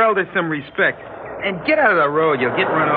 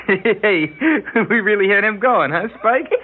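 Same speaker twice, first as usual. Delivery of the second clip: ordinary talk, nothing marked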